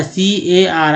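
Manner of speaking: सीएआर as three letter names, "see-ay-ar"; wrong spelled-out reading. Shown as a demonstration. Teacher tells learners not to read so, spelling it out. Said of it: "ऐसा तो नहीं पढ़ते हैं".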